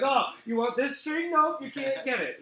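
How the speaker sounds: high, loud teasing singsong; mock taunting owner. Comic voicing a string teasing a cat, high loud taunt. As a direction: tease the cat mercilessly.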